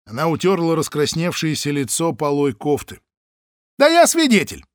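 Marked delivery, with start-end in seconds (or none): 3.8-4.7 loud, high protest; indignant cry. Acted. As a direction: throw it back at him. Паша возражает. (громкое возмущение)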